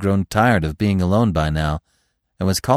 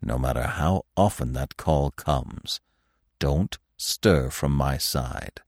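put-on old stag; 6 semitones lower, 4 dB quieter than usual. A narrator's reading. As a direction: warn gravely, deep and deliberate. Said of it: "said the old stag".

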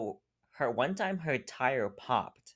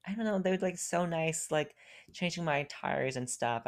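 mom voice; 8 semitones higher than usual. Retold or imitated. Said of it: "And I was like".

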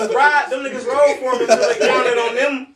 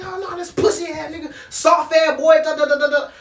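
higher-pitched mock griping; scornful complaint; 8 semitones higher, 4 dB louder than usual. Resident complaining to his boys about the storyteller, scornful. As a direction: imitate someone bad-mouthing a man to his friends. Resident bad-mouthing him to friends.

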